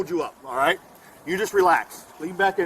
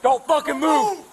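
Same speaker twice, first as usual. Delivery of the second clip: slow, higher shouted order; slow shouted command; 6 semitones higher, slower than usual. Real, not acted. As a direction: spell out each word as an order. Officer orders wounded suspect.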